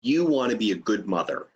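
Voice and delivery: falling statement intonation; "You want to be a good mother." falling flat at the end, no question; reflection tune. Shown as a demonstration. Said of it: "it's the inflection in my voice".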